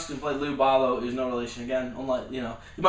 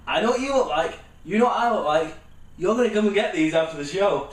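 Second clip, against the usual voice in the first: tough threat; 4 semitones higher, 4 dB louder than usual. Retold or imitated. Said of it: "he's like".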